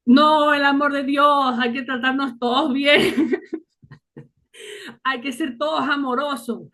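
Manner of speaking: mock sermon tone, laughing through it; laughing retelling. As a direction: imitate the priest while laughing. Priest preaching, retold with laughter. (imitando al cura entre risas)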